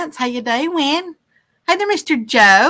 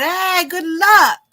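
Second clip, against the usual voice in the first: higher, slower, hammed-up sing-song good wishes; mock cheery send-off; 6 semitones higher, slower than usual. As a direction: voice a viewer's comment as a playful drawn-out cheer. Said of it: "that's the way I just said it for him".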